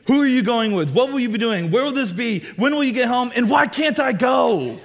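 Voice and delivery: rapid-fire interrogation, pitched high and loud; nagging mom. Retold or imitated. Pastor imitating a mom grilling her teen, high and loud. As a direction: grill the kid like a worried mom, no pauses. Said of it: "the mom just saying it so, like this".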